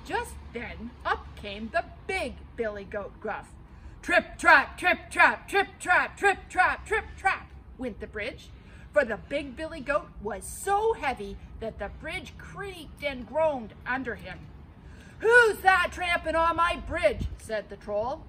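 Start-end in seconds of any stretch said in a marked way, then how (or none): 4.0-7.5 loud, rising trip trap; big goat stomping across the bridge, loud; heavy stomping. A narrator's reading. 15.2-17.3 loud higher troll roar; troll challenging the big goat, loud. Acted.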